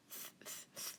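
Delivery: "th" as soft voiceless puffs; isolated breathy "th", no vowel; TH sound. Shown as a demonstration. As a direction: sound out "th" repeatedly, apart. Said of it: "T H".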